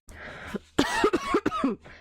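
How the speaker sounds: a real, rough chesty cough; harsh cough. Real, not acted. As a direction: cough hard from a cold. Sick streamer coughing.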